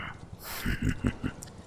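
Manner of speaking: soft, knowing breath of a laugh. Acted. Dragon amused by the coal secret, soft chuckle. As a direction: chuckle quietly to yourself.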